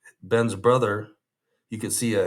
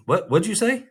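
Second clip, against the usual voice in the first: startled; 12 semitones higher, 3 dB louder than usual. Retold or imitated. Ben startled by the signal.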